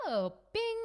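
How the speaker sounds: put-on very high, bright child's voice; kid snapping out of it. Distracted kid forgetting being upset, high and bright. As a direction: switch on like a happy kid.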